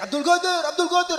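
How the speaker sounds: high-pitched mock holler of a name; acted high calling-out; neighbour's yelling. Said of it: "Ada tetangga bengak-bengok, teriak-teriak".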